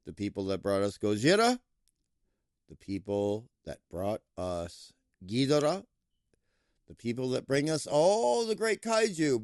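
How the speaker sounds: ordinary talk, nothing marked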